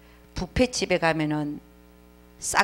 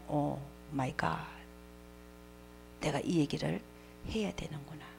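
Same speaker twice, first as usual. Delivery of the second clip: awed hush; 4 semitones lower, 14 dB quieter than usual. Real, not acted. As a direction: realize it quietly, stunned. Stunned realization, trailing off. (깨달음에 놀라 작아지는 목소리)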